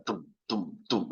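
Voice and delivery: low, soft "tum" beats; imitated bass thumps. Shown as a demonstration. Teacher showing students what bass is, low soft thumping. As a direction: mimic a bass speaker's dull thuds. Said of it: "that's the bass".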